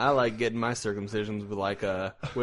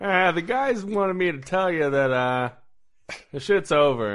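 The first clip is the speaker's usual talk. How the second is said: higher, louder put-on dude voice; lazy dude voice; 6 semitones higher, 5 dB louder than usual. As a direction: play a bored guy passing on news. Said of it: "He's like".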